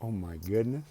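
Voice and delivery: ordinary delivery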